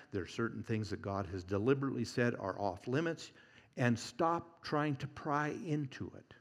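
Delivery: normal talking voice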